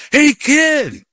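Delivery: drawn-out, very high imitated shout. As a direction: yell after someone who ignored the first call. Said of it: "He yells louder".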